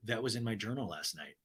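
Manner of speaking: dry quiet Ryan. Retold or imitated. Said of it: "And Ryan goes".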